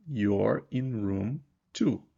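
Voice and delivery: very slow, clear teacher model sentence; slow model English. Shown as a demonstration. Teacher modelling English for a beginner, slow and clear. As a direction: spell it out slowly for beginners. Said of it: "contração de you are".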